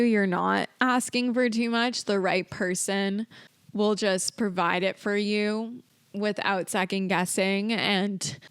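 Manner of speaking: ordinary talk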